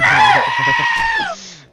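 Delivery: high, loud shriek of laughter. Real, not acted. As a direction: burst into a screaming laugh.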